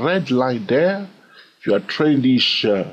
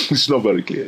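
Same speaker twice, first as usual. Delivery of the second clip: laughing it out, quicker; faster than usual. Real, not acted. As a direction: chuckle through the words.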